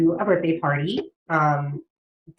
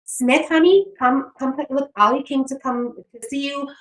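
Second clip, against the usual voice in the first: put-on high, sing-song call to the kids; sweet mom voice; 6 semitones higher than usual. Other mom at the party calling her son to play. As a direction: sweetly call the children over. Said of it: "she's like".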